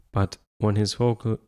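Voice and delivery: ordinary delivery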